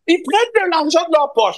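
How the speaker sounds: shouting far too loud, very high; yelling without hearing himself; too loud, headphones on. Real, not acted. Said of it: "arrête de crier".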